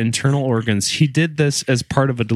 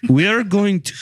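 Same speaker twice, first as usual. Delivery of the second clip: put-on a failed go at a German accent, raised high; mock German accent; 7 semitones higher than usual. Host tries a German Nazi voice, mock German accent. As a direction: start a hammed-up German accent, then break. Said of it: "oh, shit, I can't do it".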